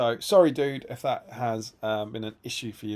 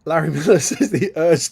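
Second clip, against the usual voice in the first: cracking up while reading the comment; laughing; 5 semitones higher, 11 dB louder than usual. Real, not acted. Reading a listener's comment aloud, laughing.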